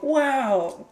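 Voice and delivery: high, loud, over-the-top "wow, good job"; mock gushing praise. Mocking how people praise a crying man with big fake awe. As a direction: gush like an impressed crowd. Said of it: "the way society responds to that is like".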